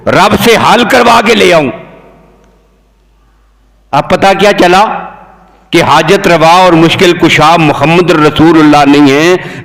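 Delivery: ordinary talk, nothing marked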